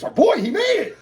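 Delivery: very high, loud rejoicing; squealed proud announcement; elated dad. Acted. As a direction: insist on the great news joyfully. Dad repeating the happy news.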